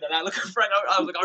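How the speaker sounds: usual speaking voice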